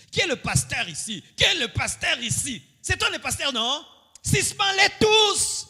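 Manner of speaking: mocking the bossy leader, building to a shout. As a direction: push each question harder until the shout.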